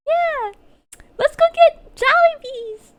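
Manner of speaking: mock high, loud casual chatter; in-person voice. Her friend meeting her in person, mock high chirpy voice. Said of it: "you're like".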